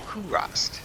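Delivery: "Kurast" drawn out as KOO-rahst; trying a pronunciation. Shown as a demonstration. Player testing the game place name to himself, trying a pronunciation. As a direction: test "Kurast" slowly with a rounded "koo". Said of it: "Probably pronounces it Kurast".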